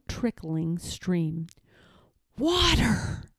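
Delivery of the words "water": hoarse whisper. A narrator's reading. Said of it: "he whispered hoarsely".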